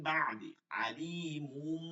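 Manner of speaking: আলিমুম said slowly with a held nasal ম; slow recitation. Shown as a demonstration. Teacher shows iqlab example to students. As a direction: draw out আলিমুম, closing on ম. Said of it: "আলিমুম পড়তে হবে".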